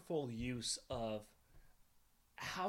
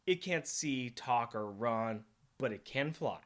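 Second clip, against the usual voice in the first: normal talking voice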